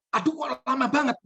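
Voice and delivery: high, whiny complaint; wife's voice. Acted. Wife complaining that it takes too long, high whiny. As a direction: moan it high as the impatient wife.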